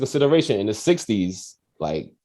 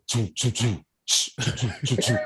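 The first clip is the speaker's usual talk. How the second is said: soft eight-bit ringtone noise; imitated ringtone; 5 dB quieter than usual. Recreating the old phone ringtone, soft imitated beeps. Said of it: "it'd be like grinding".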